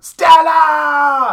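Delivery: high, loud, drawn-out cry; Streetcar-style bellow. Acted. Co-host parodies Streetcar Named Desire, anguished bellow. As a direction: holler the name in mock anguish. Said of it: "I was referencing Streetcar Named Desire".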